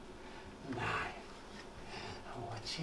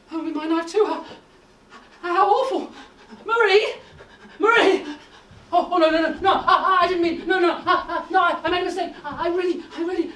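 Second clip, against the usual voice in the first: much higher, much louder, nervous laughter through it; panicked laughing cries; 7 semitones higher, 11 dB louder than usual. Acted. Professor calling Marie for help in laughing panic. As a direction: babble in panic, laughing helplessly.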